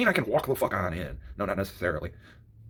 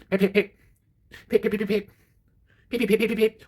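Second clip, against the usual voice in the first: high, light pip pip sounds of a horn; imitated horn pips; 9 semitones higher, 4 dB louder than usual. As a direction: tap out the horn like the girl did. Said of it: "with the fucking horn".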